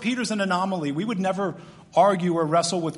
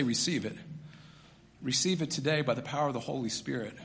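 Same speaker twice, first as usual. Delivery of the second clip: softer, lower, tender plea to receive; soft invitation; 4 semitones lower, 5 dB quieter than usual. Real, not acted. Pastor gently inviting the congregation, soft and tender. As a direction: gently urge the room to receive it.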